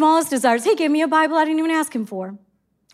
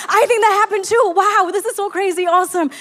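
high, eager amazement; agreeing with her son, excited; 6 semitones higher than usual. Retold or imitated. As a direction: gush with wonder. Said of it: "We're so excited".

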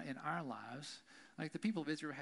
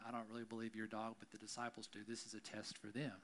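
hushed aside; 5 semitones lower, 9 dB quieter, faster than usual. Acted. Jesus's winked aside to her.

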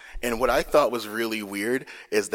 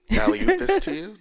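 mock offer; 13 semitones higher, 8 dB louder than usual. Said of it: "I'm like".

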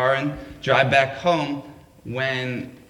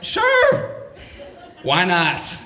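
eager yes; 3 semitones higher, much slower than usual. Retold or imitated. Eager inner voice.

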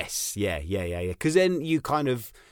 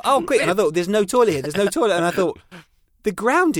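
higher, louder flustered thoughts; comic alarm, slowing at the end; mock panic; 7 semitones higher, 7 dB louder than usual. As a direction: rattle it off like a parent in a flap. Panicked inner thoughts retold.

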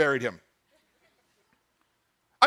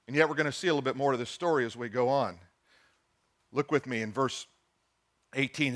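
drops quiet; 7 semitones lower, 6 dB quieter than usual. Real, not acted. Trails off quietly.

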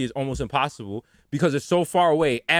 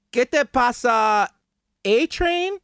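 high, slow beginner's Spanish with a questioning lift; halting Duolingo Spanish; 7 semitones higher, much slower than usual. Acted. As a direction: sound out tourist Spanish from a language app. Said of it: "using Duolingo, trying to".

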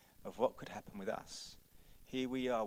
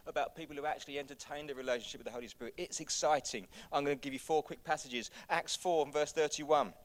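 ordinary talk

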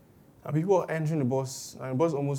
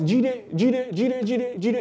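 raised, higher call across the room; calling a name; 7 semitones higher, 4 dB louder, much slower than usual. Retold or imitated. Colleague calling a distracted worker loudly by name. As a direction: call out to get his attention.

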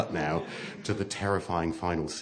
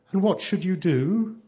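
put-on child's voice; 11 semitones higher than usual. A narrator's reading. Blue Eyes questioning her mother, childlike.

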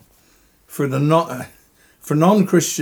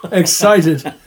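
real excitement breaking into a laugh, a bit louder; excited, laughing; 4 semitones higher, 5 dB louder, slower than usual. Real, not acted. Dying man tells interviewer about heaven, laughing with excitement. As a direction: laugh through the word excited. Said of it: "I'm so ex- excited".

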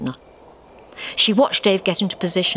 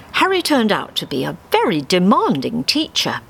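plain reading voice

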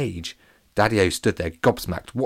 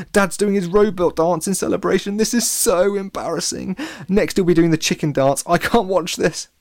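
cringe aloud in a raised voice; mortified; 10 semitones higher, 5 dB louder than usual. A narrator's reading. Alex watches his dad dance in celebration, mortified.

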